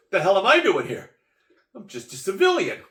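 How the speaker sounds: loud, high, alarmed outburst; mock panic. Civilian suddenly inside the Situation Room, loud mock panic. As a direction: blurt it out in hammed-up alarm.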